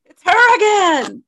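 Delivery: very high, loud shrill alarm call of a tiny bird; panicked bird voice. Pretend. Co-host voices the robin warning its flock, high and frantic.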